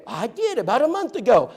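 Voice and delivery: high falsetto lady voice; bright high imitation of the newlywed; woman at the door. Woman at door answering preacher.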